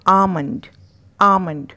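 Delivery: आमंड without any L; silent L. Shown as a demonstration. Teacher drilling the word for learners, आमंड with silent L. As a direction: drop the L in आमंड. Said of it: "एल का उच्चारण यहाँ पे नहीं करना".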